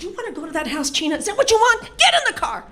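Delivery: building to a high, loud mock yell; dad snapping. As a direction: finally lose it at your wife. Dad snaps at mom.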